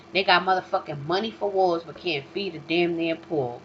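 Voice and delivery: normal talking voice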